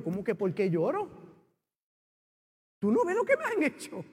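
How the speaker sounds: reenacted petulant complaint; bratty back-talk. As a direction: talk back like a wronged child. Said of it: "Yo fui malcriado con Dios".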